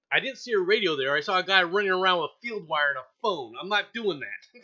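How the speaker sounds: stubborn refusal. Retold or imitated. Retold refusal to recruiter.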